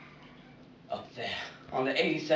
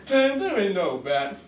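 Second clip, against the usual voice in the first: loud, higher scoff; dismissive punk; 5 semitones higher, 7 dB louder than usual. Acted. Tough punk to his frightened partner, dismissive. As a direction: scoff it out loudly.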